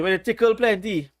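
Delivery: ordinary talk, nothing marked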